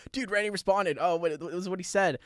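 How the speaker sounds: a touch of breathless fan; excited friend. Retold or imitated. Best friend in the car reporting Randy's reply, excited.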